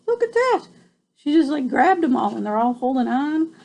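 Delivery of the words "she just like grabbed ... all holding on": plain reading voice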